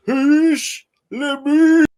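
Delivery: high, loud, slow war yell; battle cry. Retold or imitated. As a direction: roar it like a Germanic warrior. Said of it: "the quote, quote".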